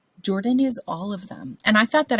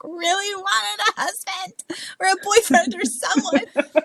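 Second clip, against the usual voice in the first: high, slow, drawn-out yearning; hammed-up wistful plea breaking into laughter; mock longing; 11 semitones higher, slower than usual. Confiding she wanted a husband, mock longing.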